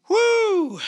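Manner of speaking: high, loud "woo" to let off steam; loud high whoop. Real, not acted. Releases tension after passion moment.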